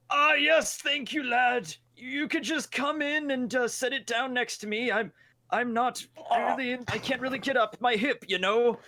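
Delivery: put-on high, slow frail old man; lightly voiced old man who can't get up; very old faint voice. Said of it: "I'm gonna pretend to be the old man".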